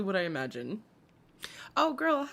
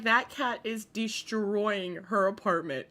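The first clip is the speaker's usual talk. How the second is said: ordinary delivery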